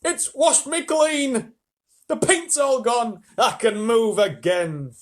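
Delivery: high, loud, slow jubilant cries; excited centipede. A narrator's reading. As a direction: celebrate being clean. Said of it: "shouted the centipede excitedly".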